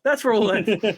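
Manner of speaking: high, loud mock-dramatic sign-off; mock announcement. DM teasing players about the cliffhanger, in a high loud mock voice. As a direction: belt it out like a cartoon villain. DM repeats line mockingly.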